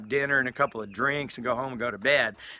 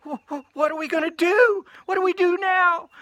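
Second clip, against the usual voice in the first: very high, loud stammering wail; mock panic; 6 dB louder than usual. Storyteller voicing Donna panicking over the cat, shrieky mock panic. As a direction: squeal it in hammed-up alarm.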